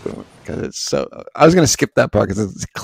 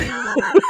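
breathy laugh. Real, not acted. Laughs at failed accent.

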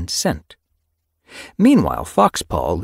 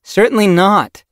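loud, raised-pitch refusal; firm refusal; 11 dB louder than usual. A narrator's reading. Senior warrior refuses eager apprentices, firm. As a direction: shut the idea down firmly.

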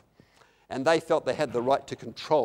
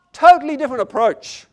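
loud, high preacher's proclamation; emphatic exclamation; 10 semitones higher, 16 dB louder than usual. Real, not acted. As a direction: belt it out to the congregation. Emphatic take, then quiet repeat.

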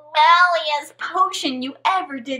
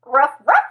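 barking dog; 6 dB louder than usual. Acted. Frog barks.

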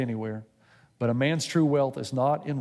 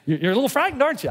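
teasing God; 10 semitones higher, 5 dB louder, faster than usual. Retold or imitated.

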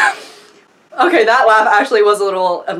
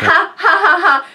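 mock laughter; 5 semitones higher than usual. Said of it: "I am gonna be cracking up".

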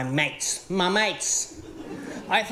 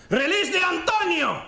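triumphant cry; 7 semitones higher than usual. Pretend. Finding the chicken heart lady, a higher hammed-up triumphant cry. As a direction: announce it like a superhero.

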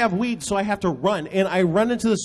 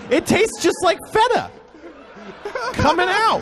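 high excited; 11 semitones higher than usual. Real, not acted. Gleeful gross-out punchline.

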